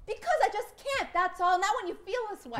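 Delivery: agitated; upset. Acted. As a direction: blurt it out, close to tears. Wife upset during fight.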